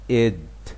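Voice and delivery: id said slowly as a clear separate syllable; the id ending. Shown as a demonstration. Teacher sounding the ending for learners. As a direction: id drawn out. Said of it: "بنطقها".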